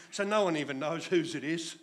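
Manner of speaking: usual speaking voice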